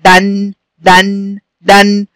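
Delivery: drawn-out دن, short a into a hummed n; fatha tanween. Shown as a demonstration. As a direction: say دن slowly as "dan" with a long n. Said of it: "يبقى إيه التنوين بالفتح؟". (نطق دن "دَنْ" ببطء)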